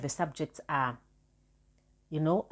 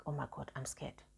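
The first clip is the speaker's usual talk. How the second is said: scared whisper; 3 semitones lower, 16 dB quieter than usual. Retold or imitated. Inner panic retold.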